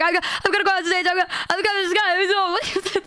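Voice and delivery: high, fast frantic babble; mock panic. Telling a friend about going on stage, mock panic. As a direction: stammer it out in a comic retold panic.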